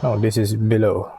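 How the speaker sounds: normal talking voice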